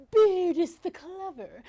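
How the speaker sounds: ordinary delivery, nothing marked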